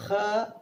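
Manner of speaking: H rasped from the throat; throat sound. Shown as a demonstration. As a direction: sound H like a small cough. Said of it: "Like when you cough".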